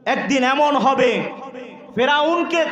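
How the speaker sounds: high, ringing sermon delivery; full-force preaching. Real, not acted. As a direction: preach it hard to the whole crowd. (জোরালো ওয়াজের সুরে, উঁচু গলায়)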